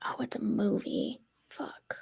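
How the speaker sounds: hushed whisper of dismay. Real, not acted. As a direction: whisper it under your breath.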